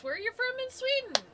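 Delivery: very high, gushing stranger imitation; mock-thrilled high squeal of interest; happily curious. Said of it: "get really happy".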